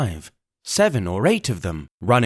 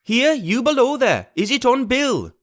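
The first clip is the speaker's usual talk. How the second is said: crying out; 13 semitones higher than usual. Acted. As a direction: shout down to the man below. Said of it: "cried the blind man again".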